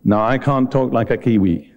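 ordinary talk, nothing marked

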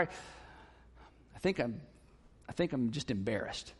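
very low, soft, halting admission; hesitant confession. Acted. Spouse admitting embarrassment behind the anger, hushed and halting. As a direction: trail into it quietly, with a pause midway.

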